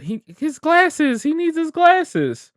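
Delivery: very high, slow imitation wail; tearful child quote. Quoting a film that made them cry, imitated tearful child. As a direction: imitate a distraught kid, drawn out and squeaky.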